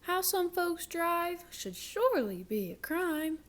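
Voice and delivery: high, prim indignant cow voice. Acted. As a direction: sniff it out like an offended cow. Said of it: "She cried".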